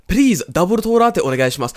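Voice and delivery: high, loud mock-Japanese ordering; hammed-up katakana accent, pushed loud and high; caricatured Japanese accent. Comic coffee order, loud exaggerated Japanese accent. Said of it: "I'm saying it in a racist accent".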